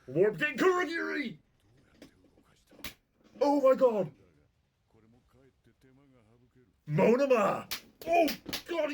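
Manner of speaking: high-pitched, loud excited outburst; erupting in ecstatic disbelief; real hype. Real, not acted. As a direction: shout it like a thrilled fan losing it. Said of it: "I'm getting hyped".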